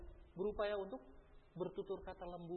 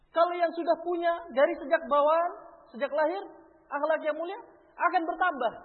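fervent preaching; 12 semitones higher, 14 dB louder than usual. Acted. Preacher raising voice on point.

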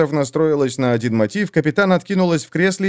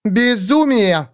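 barked; 7 semitones higher, much slower than usual. A narrator's reading. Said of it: "рявкнула Шурк".

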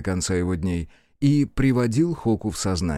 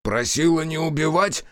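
higher, disbelieving cry; indignant outcry; 5 semitones higher than usual. A narrator's reading. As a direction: throw her words back in disbelief. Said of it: "вскричала Яшики".

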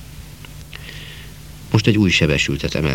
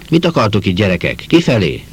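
louder, higher gruff shooing; growled order; 5 semitones higher, 4 dB louder than usual. Acted. A man shoos curious boys off the station, growling. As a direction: snap at the kids to get out. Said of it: "Mordult ránk valaki".

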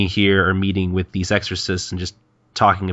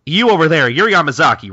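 higher, louder hail; calling out; 7 semitones higher, 7 dB louder than usual. Retold or imitated. Mummy exorcist singling out Yamazaki, calling out. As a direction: call across to someone, pitched up.

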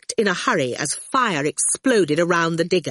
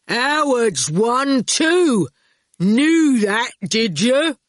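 higher, louder, drawn-out telling; blunt digger driver; 5 semitones higher, 5 dB louder, much slower than usual. A narrator's reading. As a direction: reveal it with heavy relish. Erskine's character voice.

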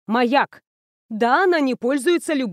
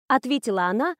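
ordinary delivery, nothing marked

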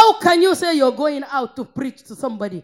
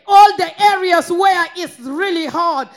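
ordinary talk, nothing marked

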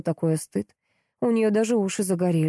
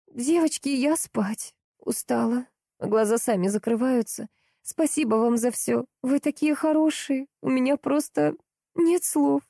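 put-on higher, drowsy young woman; sleepy tired girl voice; 6 semitones higher than usual. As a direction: yawn through the thanks, sleepy. Narrator voices tired Vika. (сонный усталый голос)

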